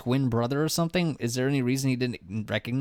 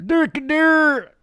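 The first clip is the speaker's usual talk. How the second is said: silly high voice; 7 dB louder, much slower than usual. Pretend.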